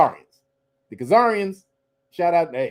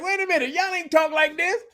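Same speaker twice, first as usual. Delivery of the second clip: higher, laughing mock callout; mock indignant shout; 10 semitones higher than usual. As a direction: squeal it out in mock outrage.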